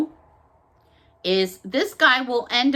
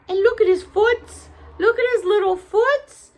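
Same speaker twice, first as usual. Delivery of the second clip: cooing over cute feet; 8 semitones higher, 4 dB louder than usual. Real, not acted. Showing viewers the gnome's feet in a high coo. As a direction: gush over the tiny feet.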